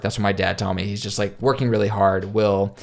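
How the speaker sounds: ordinary talk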